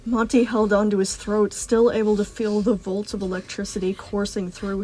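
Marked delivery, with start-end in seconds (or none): none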